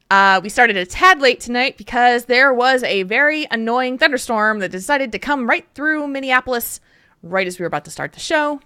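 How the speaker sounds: ordinary talk, nothing marked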